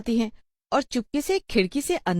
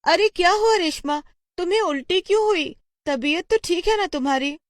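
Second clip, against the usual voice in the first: high-pitched anxious mother voice; worried mother; 12 semitones higher, 3 dB louder than usual. Acted. A mother asks why Reshma vomited, high and worried. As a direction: fuss over a sick child, voice raised high.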